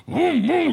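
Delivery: high, rising engine hum; loud vroom-like humming; bigger engine rev. Retold or imitated. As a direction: mimic an engine revved harder. Imitating a car revving harder.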